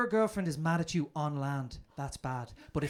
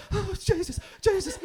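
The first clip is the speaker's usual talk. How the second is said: exhausted whine; 12 semitones higher than usual. Retold or imitated. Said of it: "I'm like".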